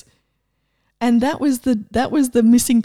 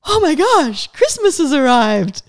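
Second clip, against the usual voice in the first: higher and louder, thrilled; childlike Christmas joy; 4 semitones higher, 5 dB louder than usual. Retold or imitated. Describing the new energy, thrilled like Christmas. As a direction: gasp like a kid at presents. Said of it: "this awareness of".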